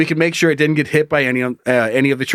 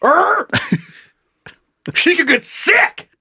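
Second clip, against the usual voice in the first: angry; 11 semitones higher, much slower than usual. Retold or imitated. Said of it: "The man angrily says".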